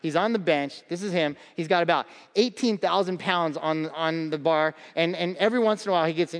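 normal talking voice

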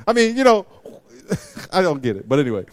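normal talking voice

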